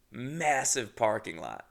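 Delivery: ordinary talk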